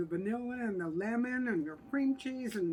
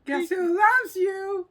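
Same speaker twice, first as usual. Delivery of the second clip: playful; 10 semitones higher, 6 dB louder, slower than usual. Real, not acted. Sign-off to viewers, playful.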